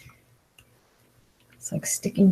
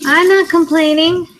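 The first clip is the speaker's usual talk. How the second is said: mock denial; 9 semitones higher, 8 dB louder than usual. Sarcastic denial, then admits it.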